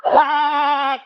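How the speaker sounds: high, loud bleating call. Acted. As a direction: bleat it like livestock. Voices racist farm animals.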